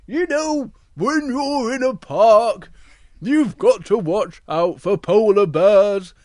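loud, slow cautioning bear; Big Bear voice. Acted. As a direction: warn the little one slowly and firmly. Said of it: "And Big Bear says to Little Bear".

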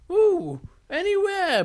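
high, loud bear exclamation; Big Bear. Acted. Big Bear answers the pigeon, high and loud. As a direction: answer with a high swooping start. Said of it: "said Big Bear".

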